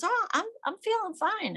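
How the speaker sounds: high, light mock-cheerful voice; a mock-cheerful high voice faking fine; husband's breezy reply. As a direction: pitch it way up, brushing it off like nothing's wrong. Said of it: "He goes".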